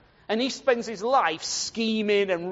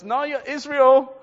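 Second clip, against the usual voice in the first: higher, louder, drawn-out insistence; insistent correction; 6 semitones higher, 8 dB louder, slower than usual. Acted. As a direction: firmly overrule them, stretching the words. Acts out the Lord insisting.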